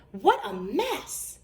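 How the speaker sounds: plain reading voice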